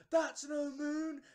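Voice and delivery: high, loud, drawn-out yell; mock crowd shout. Whole audience shouting the line before the actor, mock yell. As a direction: bellow the famous line, stretching every word. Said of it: "everyone's gone".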